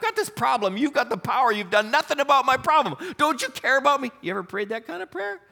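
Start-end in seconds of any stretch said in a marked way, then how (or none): 0.0-4.1 whiny complaint. Retold or imitated. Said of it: "scolding in the tone of those words".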